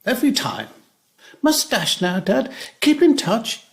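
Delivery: high, rising imitation of his daughter; daughter's parting line. Old man mimicking his daughter hurrying off the phone. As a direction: mimic her hurried sign-off. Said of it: "she says".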